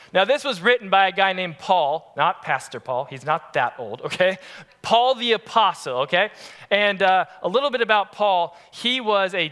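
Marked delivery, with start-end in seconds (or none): none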